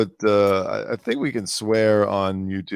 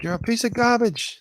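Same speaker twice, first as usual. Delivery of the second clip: high, strained mock yell of Baldwin. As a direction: sneer it at the pap like Baldwin losing it. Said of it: "Getting in his face like".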